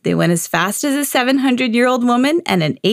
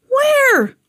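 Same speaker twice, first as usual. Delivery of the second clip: eager shout; 3 dB louder than usual. A narrator's reading. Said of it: "the old man asked".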